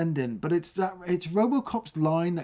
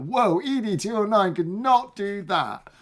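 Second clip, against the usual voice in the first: amazed whoa; 6 semitones higher than usual. Retold or imitated. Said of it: "you're like".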